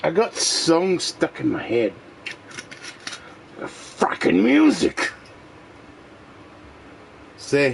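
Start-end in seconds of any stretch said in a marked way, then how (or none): 3.6-5.1 high, breathy whispered groan; exasperated whisper. Real, not acted. Fed up with a song in his head, high whisper.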